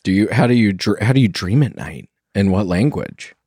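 ordinary talk, nothing marked

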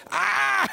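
high, loud strained "ahh"; bull rider's yell. Acted. Preacher acts out an eight-second bull ride, high yell. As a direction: yell like you're clinging to a bucking bull.

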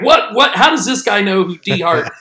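mock disbelief, voice jumping up; blown away. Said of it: "blown away, right?".

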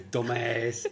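loud, mock New York Italian echo; hammy New York Italian jeer; put-on accent. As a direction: throw it back in a joking Italian-American accent. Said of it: "who started that accent".

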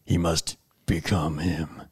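deep, slow trailer narrator; low, slow, dramatic movie voice. Shown as a demonstration. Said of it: "in your movie voice".

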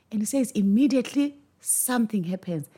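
ordinary delivery

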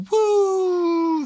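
loud, high whoop of a rocket; sudden high shout; mock woo. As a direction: whoop like a stock price shooting up. Stocks shoot up.

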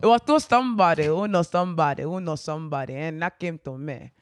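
redoing the line in a Nigerian accent. Shown as a demonstration. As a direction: put the Nigerian accent back on. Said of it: "I can't hear the accent again".

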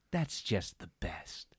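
low, slow, softly savored; drawn-out, hushed gloating; twisted satisfaction. Retold or imitated. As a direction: relish it quietly and slowly. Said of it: "twisted display of satisfaction on her face".

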